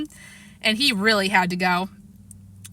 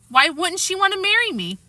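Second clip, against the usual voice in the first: puzzled; 9 semitones higher than usual. Acted. Fudge puzzled.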